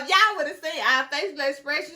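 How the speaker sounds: usual speaking voice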